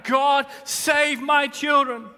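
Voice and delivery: loud, slow desperate plea; crying out. Shown as a demonstration. Preacher showing a desperate cry to God, shouting. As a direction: cry out to God for your kids. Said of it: "Cried out".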